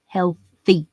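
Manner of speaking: Healthy alone, weighted with a falling end; emphatic healthy. Shown as a demonstration. Comic repeating his key word, firm stressed Healthy. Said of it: "I'm putting emphasis on this word".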